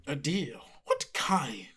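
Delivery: slow, whispery monster voice; sinister spider creature. Acted. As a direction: hiss it softly, drawing out the words.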